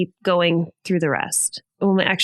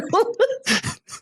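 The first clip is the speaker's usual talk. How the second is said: high laugh. Real, not acted. Laughing at son's mistake.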